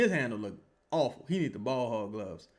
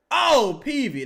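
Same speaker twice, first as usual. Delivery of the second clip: exasperated outcry; 10 semitones higher, 10 dB louder, slower than usual. Real, not acted. Reacting to bad shot.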